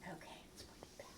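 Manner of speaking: quick hushed whisper; whispered quick aside. Real, not acted. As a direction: slip it out under your breath, fast. Aside while putting puppet away.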